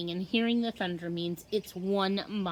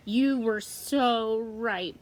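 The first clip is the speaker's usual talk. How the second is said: slow, louder shout over the rain; yelling while running; 2 semitones higher, 5 dB louder, much slower than usual. A narrator's reading. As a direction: call it out as she runs. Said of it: "Brandy yells as she runs".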